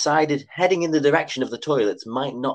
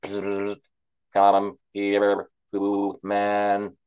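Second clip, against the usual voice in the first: eerie low robot whisper; a hushed machine voice calling; robotic whisper; 3 semitones lower, much slower than usual. A narrator's reading. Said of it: "the strange robotic-sounding whisper".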